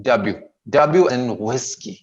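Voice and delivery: slow W as dab-yu, no L; W without L. Shown as a demonstration. Teacher repeats for pupils, W without L. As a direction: leave the L out of W before whiskey. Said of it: "ल नहीं आता है इसमें".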